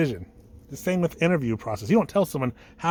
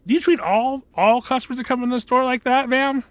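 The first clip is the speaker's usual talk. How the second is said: high, mock-indignant customer; hammed-up affronted caller; indignant challenge; 9 semitones higher, 4 dB louder than usual. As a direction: call out the receptionist, pitched well up. Caller challenging a rude gatekeeper.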